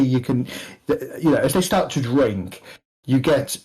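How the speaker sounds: ordinary talk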